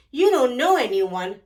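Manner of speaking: plain reading voice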